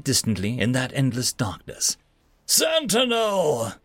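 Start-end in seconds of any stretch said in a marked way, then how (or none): 2.5-3.7 booming, drawn-out call from a higher pitch; slow, high, thunderous summons. A narrator's reading. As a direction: call his name like a vast fire spirit. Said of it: "The light called out to me in a thunderous voice".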